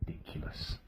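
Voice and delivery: breathy exasperated whisper under the breath. Acted. Husband fed up with his wife, whispering to himself. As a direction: hiss it to yourself in disbelief.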